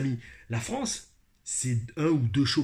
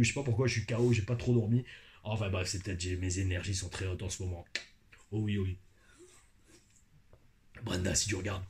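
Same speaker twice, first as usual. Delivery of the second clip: normal talking voice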